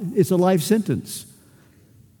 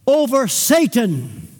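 normal talking voice

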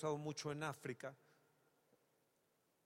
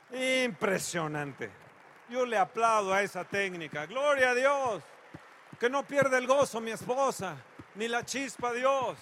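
much louder, higher pulpit fervor; full-force preaching; 6 semitones higher, 12 dB louder than usual. Acted. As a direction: preach it out with joyful fire.

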